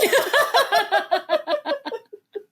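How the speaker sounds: delighted high laugh. Real, not acted. Laughs at [name] post.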